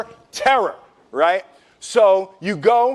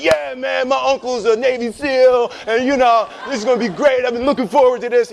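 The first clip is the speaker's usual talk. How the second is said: fake bravado; 5 semitones higher than usual. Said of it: "They're like".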